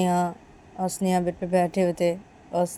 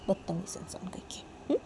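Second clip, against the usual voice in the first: whispered insult; 13 dB quieter than usual. Acted. Sneha insults Neha.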